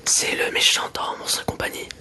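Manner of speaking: ordinary talk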